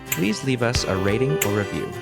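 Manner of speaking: plain reading voice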